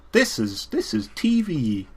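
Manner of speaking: mock showy delivery, climbing high; hammed-up soap opera. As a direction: overplay it as obviously fake telly. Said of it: "comes across like a soap opera".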